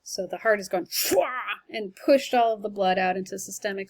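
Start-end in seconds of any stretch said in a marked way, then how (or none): 0.9-1.2 high burst "fwah" noise; whoosh sound effect. Retold or imitated. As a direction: mimic a heartbeat push. Imitating heart pushing blood.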